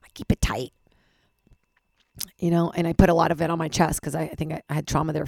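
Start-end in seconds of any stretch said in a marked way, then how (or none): 0.0-0.7 whispered. Real, not acted. Tense confession whispered.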